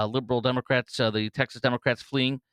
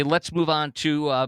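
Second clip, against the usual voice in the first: ordinary talk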